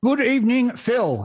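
high-pitched mock old-time explorer's proclamation voice; Sir Douglas Mawson. Guest greets radio host by mimicking the explorer, high mock-proclamation voice. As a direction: put on a high, declaiming archival-recording voice. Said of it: "my Sir Dougie Mawson voice".

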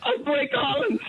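high squealing laugh through the words. Real, not acted. Caller giddy after winning, laughing. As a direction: laugh it out with delight.

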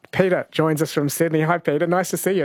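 ordinary talk, nothing marked

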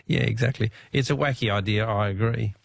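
ordinary delivery

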